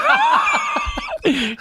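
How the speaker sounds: long, very high helpless cackle; shrieking laugh. Real, not acted. Storyteller about the court date, helpless high cackle. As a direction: lose it in a squealing laugh. Cracks up at his own story.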